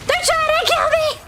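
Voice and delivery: high laughing shriek; laugh-yell for help; laughing panic. Real, not acted. Streamer laugh-shouting high to teammates.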